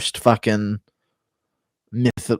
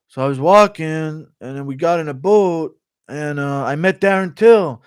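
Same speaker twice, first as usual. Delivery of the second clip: Ortega impression; 6 semitones higher, 3 dB louder than usual. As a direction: draw the story out, pitched up. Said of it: "Ortega and Till on a fishing trip impression".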